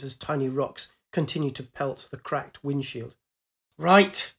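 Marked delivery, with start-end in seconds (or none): 3.8-4.3 sharp louder burst of resolve; determined. Acted. Pilot steeling herself at the controls, determined. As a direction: snap into action.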